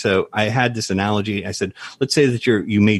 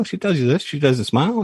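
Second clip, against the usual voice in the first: ordinary talk, nothing marked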